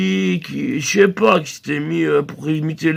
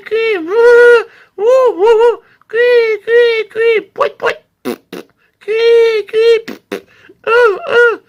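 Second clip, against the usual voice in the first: loud high falsetto cui and wouh calls; a string of loud high bird cries; bird-call code; 7 dB louder, much slower than usual. Shown as a demonstration. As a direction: chirp and hoot like courting birds. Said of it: "ça aurait donné un truc du genre".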